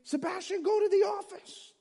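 high-pitched yelled order; teacher yelling. Retold or imitated. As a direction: shriek it like a furious teacher. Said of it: "The teacher started screaming".

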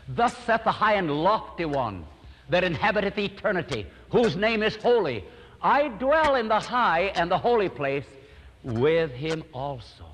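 rising, slowing pulpit recitation; solemn, exalted scripture reading; preached scripture. Retold or imitated. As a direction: lift the verse up high and draw out the end.

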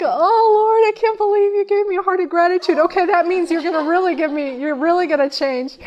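overwhelmed, half laughing, half crying to the Lord; crying out thanks in disbelief; tearful joyful prayer. Retold or imitated. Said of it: "Well, now I'm really crying".

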